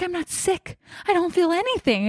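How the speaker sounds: high-pitched retold protest; baffled, pitched well up; disbelieving protest.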